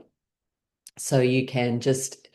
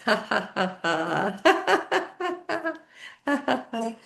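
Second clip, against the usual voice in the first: forced laughter; 8 semitones higher than usual. Shown as a demonstration. Teacher leads class in laughter exercise, high laughing. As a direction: laugh it out on purpose, pitched up high. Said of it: "we're gonna do it with laughing".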